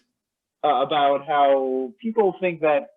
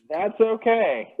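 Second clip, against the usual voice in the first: slow, laughing reassurance; 6 semitones higher, slower than usual. Real, not acted. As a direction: chuckle it out slowly. Reassuring co-host while laughing.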